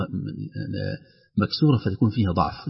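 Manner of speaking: ordinary talk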